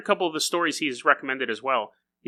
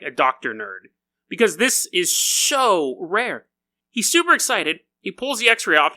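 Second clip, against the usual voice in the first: usual speaking voice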